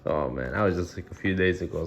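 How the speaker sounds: ordinary delivery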